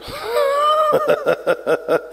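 a laugh shot way up high; high squealing laugh. Acted. Teller laughing at the lions in class, high squeal. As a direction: burst into a high giggle. Lion-student laughing in scene.

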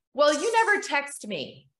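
a touch of defensive retort. Retold or imitated. Mother answering daughter's complaint, mildly defensive. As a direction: snap back lightly, hurt and defensive. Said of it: "That's what I said instead".

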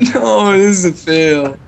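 ordinary delivery

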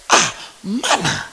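shocked. Retold or imitated. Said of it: "They were all shocked".

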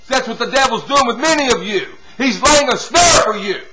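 normal talking voice

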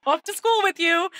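high-pitched breezy send-off; exaggerated chirpy dismissal; mock cheery parent. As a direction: imitate a parent shooing a child off.

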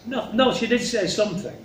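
usual speaking voice

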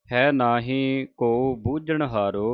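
slow recitation with a pause after ਹਾਰੋ; pause shown. Shown as a demonstration. Teacher recites the Gurbani line to the sangat with a pause. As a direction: recite ਹੈ ਨਾਹੀ ਕੋ ਬੂਝਣ ਹਾਰੋ slowly, then stop after ਹਾਰੋ. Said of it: "ਇੱਥੇ ਵਿਸਰਾਮ ਹੈ".

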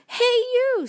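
high, loud storybook call-out; grouchy challenge. A narrator's reading. Grouchy ladybug accosting a stranger, high loud challenge.

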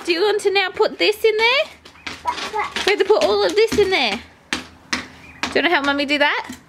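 high, bright mum voice to a little one. Real, not acted. Mum coaxing her toddler to help with the washing, high and fast.

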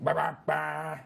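hammed-up Southern twang, done on request. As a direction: do the Southern Vine voice again on request. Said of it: "Do it again one more time".

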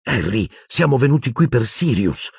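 high, exasperated reminder; exasperated, stress on qui; shrill, exasperated. A narrator's reading. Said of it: "con voce acuta, esasperata".

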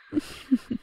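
light, amused witch laugh; a knowing little laugh. Acted. Witch amused by hunter's question.

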